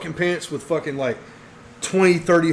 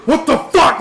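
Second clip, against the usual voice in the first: louder, higher outraged yell with a laugh; angry outburst; 10 semitones higher, 7 dB louder, slower than usual. Retold or imitated. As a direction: explode at the guy across the table. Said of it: "He's like".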